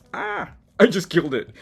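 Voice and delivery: loud cry over a torn page; real shout of dismay. Real, not acted. Artist yelps as her collage paper tears, loud.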